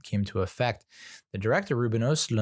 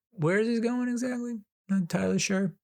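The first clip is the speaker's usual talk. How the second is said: mock puzzlement; 12 semitones higher than usual. Reviewer voicing a lost viewer, high mock puzzlement.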